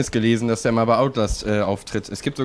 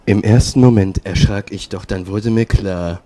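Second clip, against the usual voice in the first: low, solemn reading, slowing down; mock-serious romance narrator; romantic story narration; 4 semitones lower, 4 dB louder, slower than usual. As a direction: read the love scene in a lowered, earnest voice. Reads romantic fanfiction.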